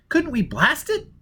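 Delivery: put-on high, urgent young woman's voice; Jiz's high voice. Jiz calling Foyle over the earphones, high and agitated. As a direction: pitch way up and press with worry.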